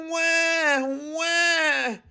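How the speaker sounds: put-on high wailing baby cry; loud baby wah-wah; baby whining cry. A narrator's reading. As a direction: wail like a fussy toddler. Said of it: "he whined".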